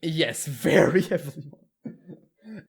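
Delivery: slow, laughing agreement; amused agreement. Real, not acted. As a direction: draw it out through a real laugh. Laughs while agreeing with joke.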